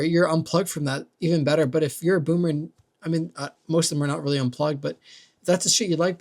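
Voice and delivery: ordinary delivery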